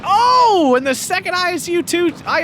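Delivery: high loud excited oh; excited shout. Real, not acted. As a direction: shout out at the big hit. Excited play-by-play.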